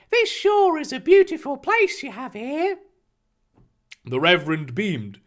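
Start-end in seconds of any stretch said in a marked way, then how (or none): 0.1-2.8 put-on high eager boy's voice; enthusiastic Harry. Harry greeting the Reverend, eager high voice. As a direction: greet him brightly, pitched up.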